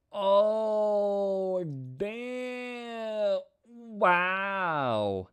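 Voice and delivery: high, amazed outburst at the chart; excited. Real, not acted. Trader to viewers over a winning trade, thrilled. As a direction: let it burst out, delighted. Celebrating a trade hitting target.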